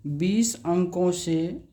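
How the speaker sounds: each word drawn out, slow and even; बीस अंकों से said very slowly for students to copy; slow dictation. Shown as a demonstration. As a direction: spell it out slowly, like dictating to a class. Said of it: "teacher dictates slowly as he writes".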